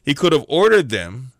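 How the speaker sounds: ordinary delivery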